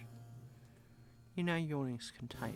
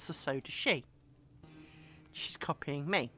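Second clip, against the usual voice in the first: ordinary talk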